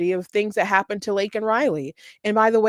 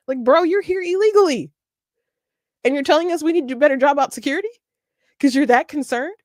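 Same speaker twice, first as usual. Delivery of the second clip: higher-pitched, exasperated disbelief; incredulous retort; 7 semitones higher, 3 dB louder, faster than usual. Acted. Commentator answering migrants who want more security, incredulous. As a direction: throw it back at them, pitched up in disbelief.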